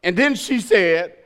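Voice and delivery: ordinary talk, nothing marked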